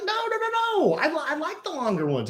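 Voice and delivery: high, excited protest at the podcast host; cutting in to insist longer episodes are better; emphatic protest. Retold or imitated. Retelling to partner, excited high protest. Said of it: "And I'm like".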